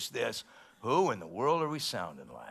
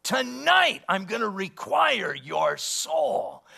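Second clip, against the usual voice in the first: much higher, loud, slow divine rebuke; voice of the Lord; 10 semitones higher, 6 dB louder, slower than usual. Retold or imitated. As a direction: pronounce judgment slowly from on high. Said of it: "The Lord said to that man".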